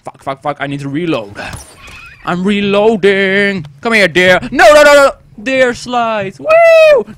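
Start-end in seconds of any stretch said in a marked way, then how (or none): 2.2-7.1 louder, higher excited gamer shouts; frantic yelling. Real, not acted. Gamer chasing a deer down a slide, excited shouting. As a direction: yell at the game as the chase peaks.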